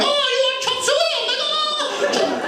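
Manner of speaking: very high mock Chinese accent. Shop owner mocking a Chinese customer, very high mock Chinese accent. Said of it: "a Chinese guy goes in".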